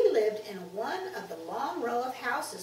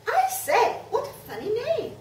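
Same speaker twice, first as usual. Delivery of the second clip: high, loud exclamation; girl blurting out her surprise; amused surprise; 12 semitones higher, 8 dB louder than usual. A narrator's reading. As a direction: exclaim like an astonished child. Girl reacts to boy's name.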